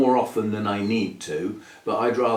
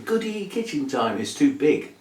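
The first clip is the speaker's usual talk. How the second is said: higher-pitched mock glee; imitating eager viewers; 6 semitones higher than usual. Host mimics viewers hoping for kitchen repotting, mock excited. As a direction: put on the viewers' gleeful little cheer. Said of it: "all of you who are going".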